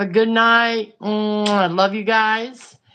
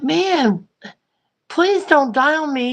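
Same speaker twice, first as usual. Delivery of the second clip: higher pleading plea to a stalling cart; 6 semitones higher than usual. Retold or imitated. To her dying mobility cart, a higher mock plea. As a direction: beg the cart to keep going. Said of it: "And I'm like".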